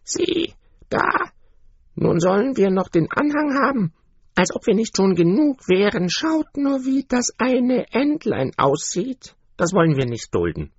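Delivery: high, sneering duck voices; scornful other ducks. A narrator's reading. Yard ducks jeer at the ugly duckling, high and sneering. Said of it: "sagten ganz laut".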